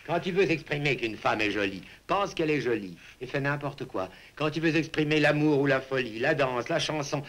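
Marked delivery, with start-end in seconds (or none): none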